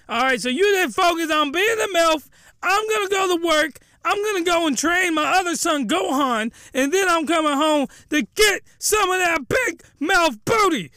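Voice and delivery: high, hammed-up angry orders; angry husband. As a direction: lay down the law in mock anger. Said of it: "he's a really angry, silently angry man".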